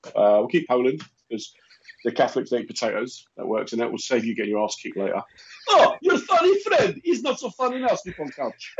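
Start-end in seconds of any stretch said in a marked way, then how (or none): 5.7-8.7 high put-on foreign-wife voice, broken English; hammed-up wife telling him off; mock angry wife. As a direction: play the scolding wife, pitched way up. Mock wife scolding husband.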